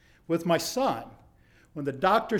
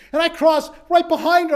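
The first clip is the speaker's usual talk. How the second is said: ordinary talk, nothing marked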